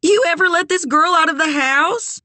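high-pitched teasing holler; calling out. A narrator's reading. Sugar asks Eleanor about Kit through the doorway, calling out high. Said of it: "Sugar called through the doorway".

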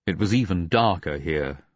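ordinary delivery, nothing marked

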